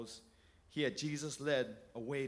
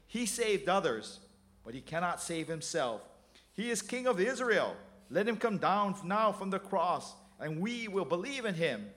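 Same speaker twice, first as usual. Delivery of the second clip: mocking; 4 semitones higher, 4 dB louder than usual. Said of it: "mocked him".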